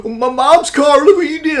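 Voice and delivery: put-on high, whiny panicked teenager; freaking out kid. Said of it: "he's freaking out, going".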